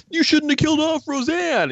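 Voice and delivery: high, whiny mock complaint; whiny complaining fan. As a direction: whine it like an upset fan. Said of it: "all these people are gonna be like".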